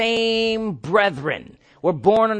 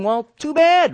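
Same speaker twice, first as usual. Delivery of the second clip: defiant retort; 8 semitones higher, 4 dB louder than usual. Real, not acted. Defiant reply to objection.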